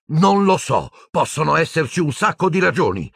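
high-pitched exasperated outburst; yelled. A narrator's reading. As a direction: cry out, out of patience. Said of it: "urlò Harry". (urlando esasperato, su toni acuti)

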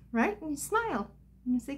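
normal talking voice

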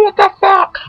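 high-pitched outraged squeal; fuming. Retold or imitated. Gamer recalling being betrayed by a friend, fuming squeal. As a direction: squeal it out, reliving the fury. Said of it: "I was just fuming".

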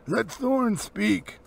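put-on weighty words from an old wizard; slow, grand wizard's order; commanding wizard. A narrator's reading. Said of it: "said Gandalf".

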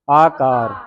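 ਆਕਾਰ with a long opening aa; long first vowel. Shown as a demonstration. Teacher spells out the word for the class, long-vowelled ਆਕਾਰ. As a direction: draw out both aa sounds in ਆਕਾਰ. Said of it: "ਐੜੇ ਕੰਨਾ ਹੈ, ਕੱਕੇ ਕੰਨਾ ਰਾਰਾ ਹੈ".

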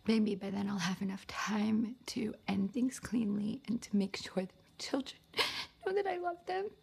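choked-up, halting love-letter reading; tearful confession. Acted. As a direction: fight back tears while confessing, breath catching between phrases. Confessing affair, fearing for her children.

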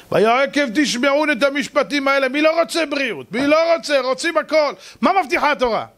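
high-pitched sermon at full force; fiery preaching. Acted. Preacher pressing the congregation about what the Torah promises, fiery preaching. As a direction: hammer the point home to the crowd.

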